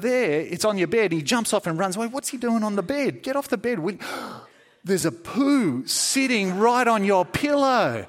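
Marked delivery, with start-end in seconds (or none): 2.1-3.8 telling the dog off. Pretend. Scolding dog on bed. 3.8-8.0 shocked gasp. Pretend. Shocked at poo on pillow.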